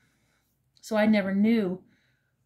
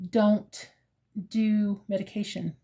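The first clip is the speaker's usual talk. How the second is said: ordinary talk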